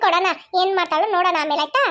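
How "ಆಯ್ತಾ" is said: ordinary talk, nothing marked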